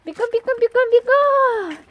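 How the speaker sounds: high, excited urging; squeaky praising a dog. Real, not acted. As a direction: cheer the dog on.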